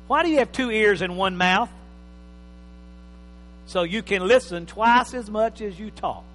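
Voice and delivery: ordinary delivery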